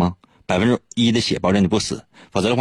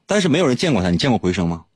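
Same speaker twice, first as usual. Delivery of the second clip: ordinary delivery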